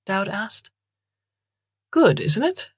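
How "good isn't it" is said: high, pleased boast; proud. A narrator's reading. Bloom praises Moocher's poem to Dowd, proud. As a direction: show it off, pitched well up. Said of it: "Bloom said proudly".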